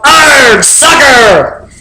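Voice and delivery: pirate captain yelling as he kicks. Acted. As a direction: growly yell. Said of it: "kicked the ball and yelled".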